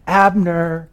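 high, drawn-out distant call. Acted. David crying out to the sleeping commander, a high call. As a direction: call across a valley without full force. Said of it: "begins to cry out to the commander".

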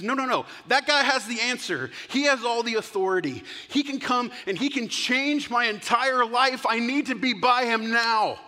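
high, loud, desperate protest; urgent insistence. Acted. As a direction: insist with rising desperation, refusing to be hushed. Blind man refuses to be silenced.

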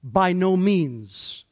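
"By no means." slowed right down, each word weighted; emphatic denial. Acted. Preacher giving Paul's answer to the congregation, slow and emphatic. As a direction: land "By no means" slowly and firmly. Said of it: "his answer is an emphatic".

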